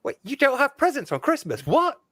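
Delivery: high-pitched imitation of a stunned viewer; incredulous. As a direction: jump up in pitch with mock disbelief. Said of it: "must have been like".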